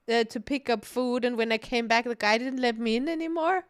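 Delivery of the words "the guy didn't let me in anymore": ordinary talk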